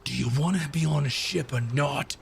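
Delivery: impatient put-on bosun voice; lightly voiced, exasperated crew boss; Karzak. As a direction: challenge the reluctant crew. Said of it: "Karzak's like".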